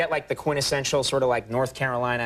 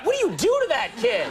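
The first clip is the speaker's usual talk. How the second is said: higher, alarmed neighbor asking; shocked neighbors; 13 semitones higher than usual. Retold or imitated. The host voices neighbors shocked at how his mom treats him. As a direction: gasp it like a scandalized neighbor. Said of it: "they'd be like".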